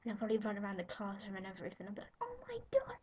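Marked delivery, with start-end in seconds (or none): 2.2-3.0 much higher shrieked oh my god; pretend fangirl shriek. Girl acting out the celebrity call for viewers, high shriek. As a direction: shriek like you just got the call. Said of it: "I just did that on camera".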